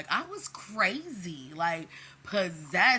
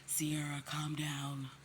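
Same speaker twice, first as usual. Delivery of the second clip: soft, calm, half-whispered "calm down"; therapist voice; 4 semitones lower, 6 dB quieter, slower than usual. Retold or imitated. Dad calming his daughter in a soft therapist voice. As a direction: soothe her like a patient therapist. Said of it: "he puts on his, like, therapist voice".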